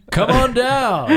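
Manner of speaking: high, loud, drawn-out host's call; mock game-show announcer. Calling the pretend surprise guest down, mock game-show announcer.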